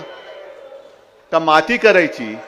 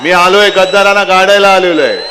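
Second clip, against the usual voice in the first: rally shout. Real, not acted. Rally climax against traitors.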